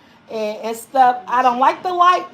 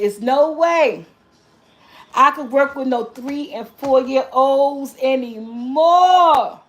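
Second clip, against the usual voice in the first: slow firm refusal; slower than usual. Retold or imitated. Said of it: "I said".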